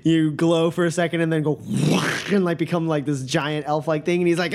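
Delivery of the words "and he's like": ordinary delivery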